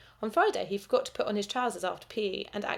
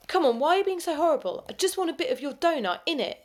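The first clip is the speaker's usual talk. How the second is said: loud, high whiny pestering; a bully whining for a share of the donut; 8 semitones higher, 5 dB louder than usual. A narrator's reading. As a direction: badger him loudly like a cheeky bully.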